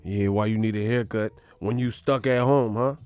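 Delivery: low-key, taunting interrogation; Denzel. Acted. Governor as movie cop questioning quarantine breakers, quiet taunting menace. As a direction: needle them coolly like a crooked movie cop. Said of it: "like Denzel and shit in Training Day".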